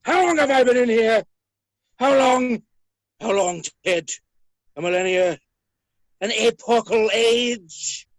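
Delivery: loud theatrical lament; indignant outcry. Acted. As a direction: boom it out like an outraged villain. Trapped villain demands to know how long.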